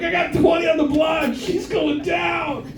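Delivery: high, shouted bet called out; rowdy betting shout. Retold or imitated. Old guys outside the club betting on drunk girls, rowdy high shout.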